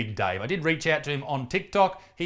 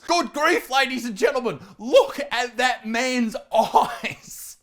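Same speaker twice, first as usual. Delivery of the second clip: high-pitched, appalled exclamation; mock horror; 7 semitones higher, 4 dB louder, slower than usual. Real, not acted. Host reacting to a man's terrifying stare, mock horror. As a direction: cry it out high in comic disbelief.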